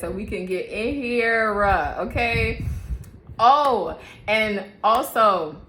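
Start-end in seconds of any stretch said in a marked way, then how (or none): none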